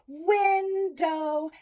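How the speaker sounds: stretched call; dog calling. Shown as a demonstration. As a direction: call Window across the room. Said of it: "let's do the first syllable".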